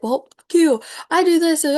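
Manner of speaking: mock angry outburst. Person called out on their behaviour, mock furious retort. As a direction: play the offended person lashing out.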